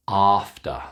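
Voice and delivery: "After" said ahfter; long ah. Shown as a demonstration. Teacher models word for practice, long ah in "After". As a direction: stretch the start of "After" to ah. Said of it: "It's the long ah sound".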